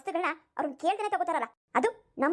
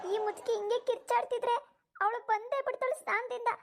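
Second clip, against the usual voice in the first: higher, softer, slow worried aside; nervous aside; 5 semitones higher, 3 dB quieter than usual. Acted. Daughter-in-law fretting she'll be caught with the makeup, higher and softer. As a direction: fret to yourself, afraid of being caught.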